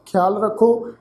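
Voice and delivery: ordinary talk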